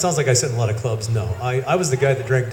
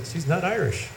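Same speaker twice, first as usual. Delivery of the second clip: higher, softer insistence, falling on Irish; retold gentle insistence, pitched up; insistent repeat; 5 semitones higher, 5 dB quieter than usual. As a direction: quietly stand your ground on a raised pitch. Repeats verdict to disbelieving friend.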